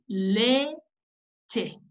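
"Leche" split, long "le", gap, then "che"; sounding out. Shown as a demonstration. Teacher pointing out the letters, "Leche" syllable by syllable. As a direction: hold "le", pause, add "che" to finish "Leche". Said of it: "ese es su sonido".

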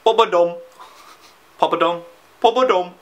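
comic attempt at an Indian accent. Shown as a demonstration. Vlogger trying what he can say in India, mock Indian accent. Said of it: "if I headed over to India".

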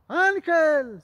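loud high call of a nickname. Retold or imitated. Sister looking for her brother, loud high calling. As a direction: call out to find him in the crowd.

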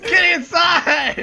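loud, slow, crying out; slow desperate yell. Real, not acted. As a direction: drag the yell out in panic. Yelling at game character.

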